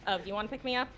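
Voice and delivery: ordinary talk, nothing marked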